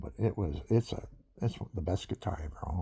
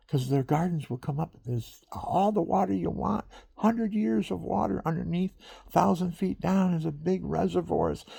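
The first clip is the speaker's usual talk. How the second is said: fervent preaching; 8 semitones higher than usual. Real, not acted. Preaching about Africa.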